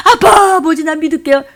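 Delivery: high, loud wail on calling Papa; tearful plea. Acted. Repentant believer calls to God the Father, tearful plea. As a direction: cry out to a father like a pleading child. Acts out pleading prayer to Father.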